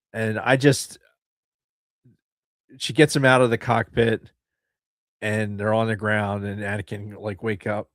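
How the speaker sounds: ordinary talk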